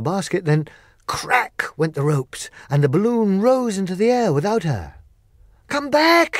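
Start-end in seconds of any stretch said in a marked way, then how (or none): 5.7-6.4 high, loud desperate scream. Acted. Dorothy screaming at the departing wizard, high and loud. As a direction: yell after the rising balloon. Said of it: "she screamed".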